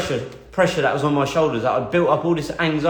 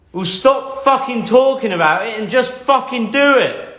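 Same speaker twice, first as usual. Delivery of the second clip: imitating a dad's higher, raised-voice outburst; acted exasperated telling-off, pitched up; 6 semitones higher, 4 dB louder than usual. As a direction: blurt it out like a fed-up father. Dad snaps back in retold quarrel.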